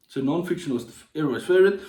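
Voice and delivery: normal talking voice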